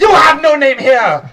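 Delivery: high squeaky shout; shouting kobold. Acted. Kobold cook shouting at the new servant, high and loud. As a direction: yell it down at the lowly newcomer. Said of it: "one of the kobolds stops and goes".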